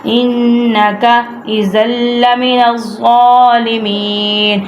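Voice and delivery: slow, measured tajweed recitation with repeats; Quran recitation. Shown as a demonstration. Teacher recites verse for students to follow, measured chant. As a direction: chant each phrase, then repeat it.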